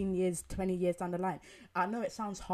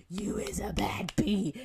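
sudden whispered hype, slow; 7 dB louder, slower than usual. Retold or imitated. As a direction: lean in and whisper the compliment. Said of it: "I'm like".

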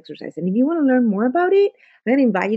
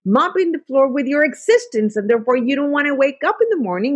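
ordinary talk

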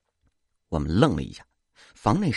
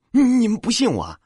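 much higher, louder stammering protest; outraged that they doubt him; agitated stammer; indignant protest; 13 semitones higher, 6 dB louder than usual. A narrator's reading.

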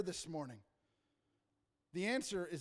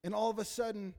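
usual speaking voice

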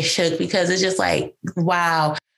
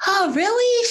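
high-pitched, pleasantly surprised question; 12 semitones higher than usual. Retold or imitated. Reacting to sister's offer, surprised. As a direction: re-enact a delighted double take, voice lifted high.

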